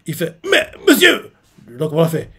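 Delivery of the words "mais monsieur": high, startled "Mais monsieur"; a young recruit caught off guard, voice jumping up; shocked protest. Retold or imitated. Retelling a recruit protesting to his commander, high and startled.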